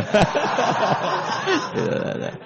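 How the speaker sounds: long airy high giggle; high laugh. Real, not acted. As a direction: keep laughing high and breathless. Laughter bursts out.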